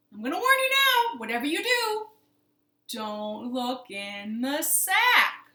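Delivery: big storybook fox warning; emphatic warning. Acted. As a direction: warn dramatically, stretching the final words. Fox warns the woman, acted.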